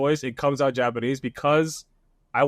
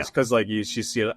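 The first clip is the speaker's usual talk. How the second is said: normal talking voice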